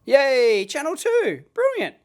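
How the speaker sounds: high-pitched, delighted celebration; gleeful cheer. Real, not acted. Tester cheers as channel two shows up, gleeful. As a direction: cheer the gadget for getting it right.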